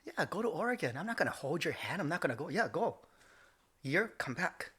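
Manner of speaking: dad's brisk, unbothered send-off, imitated. Immigrant dad sending son to Oregon, imitated offhand. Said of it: "for him, it's like".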